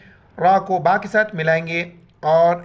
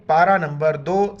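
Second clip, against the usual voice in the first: ordinary talk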